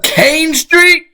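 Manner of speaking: real high, drawn-out disbelief; stunned recognition. Real, not acted. Streamer hearing a familiar street named, stunned. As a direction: voice leaps up slowly into a startled question.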